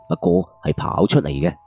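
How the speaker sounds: ordinary delivery, nothing marked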